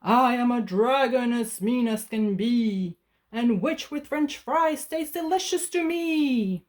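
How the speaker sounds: gloating monster voice; menacing dragon. Acted. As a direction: threaten your dinner with relish. Dragon threatens the witch.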